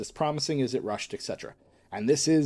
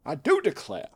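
put-on Southern belle accent; 4 semitones higher than usual. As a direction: declare it like a Southern lady. Southern catchphrase.

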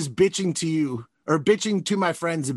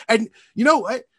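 usual speaking voice

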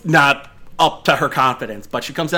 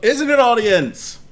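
high showy host voice; contest announcer; 7 semitones higher than usual. Acted. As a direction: work the crowd like a host. Announcer asks audience.

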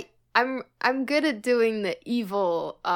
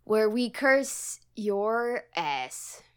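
slow spooky tagline; much slower than usual. Acted.

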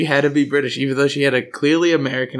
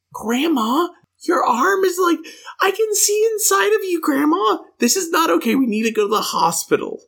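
much higher, panicked pleading; alarmed. Retold or imitated. Grandchild urging grandma to hospital, high and alarmed. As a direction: sound shocked at the wound. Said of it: "I'm like".

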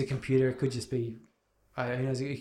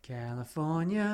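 slow dramatic echo; 5 dB quieter, slower than usual. Pretend. Co-host echoing the dramatic line, slow and soft. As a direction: draw out California slowly and softly.

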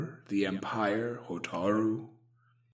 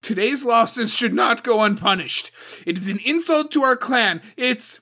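loud, high, furious insistence; harsh angry demand; 12 semitones higher, 10 dB louder than usual. A narrator's reading. Suko demands vengeance from the council, harsh and loud. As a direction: batter them into agreement. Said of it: "the harshness of her voice".

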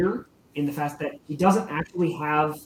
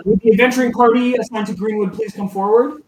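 louder, higher, fast announcement; formal summons; 6 semitones higher, 9 dB louder than usual. Acted. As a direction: call the party forward to the hall. Vasha summons party.

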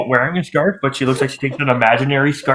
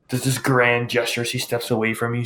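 ordinary talk

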